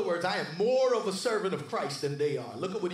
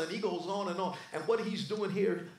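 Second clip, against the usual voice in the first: normal talking voice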